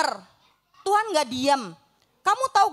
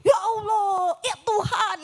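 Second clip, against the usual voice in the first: mock frightened cry; 6 semitones higher, slower than usual. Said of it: "semua pada teriak".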